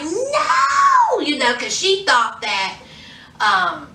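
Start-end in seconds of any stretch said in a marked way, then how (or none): 0.0-1.2 the horror of finding everything gone; dramatic imitated scream of no; anguished no. Eve finding room cleaned out.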